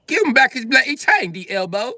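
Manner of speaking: put-on very high, loud British accent; British demand. British bystander demanding the chain back, high British accent. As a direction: squawk it as an indignant Briton. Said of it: "Oh, he's British".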